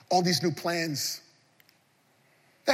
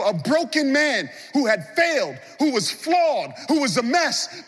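high, loud preaching that pushes out to the room; full-force preaching; 9 semitones higher, 4 dB louder than usual. Acted. Preacher calling the church to God, full-force preaching. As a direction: preach it hard to the congregation.

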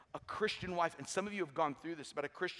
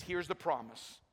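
ordinary talk

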